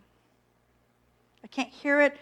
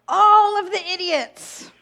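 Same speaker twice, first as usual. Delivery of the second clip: higher, louder, slow scornful sneer; mock contempt; 7 semitones higher, 8 dB louder than usual. Parodying her own scornful judgment of others, slow sneer. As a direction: drag it out with disdain.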